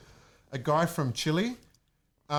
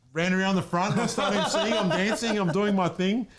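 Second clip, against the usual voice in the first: excited retelling; 5 semitones higher, 3 dB louder than usual. Real, not acted. Telling excited stage moment.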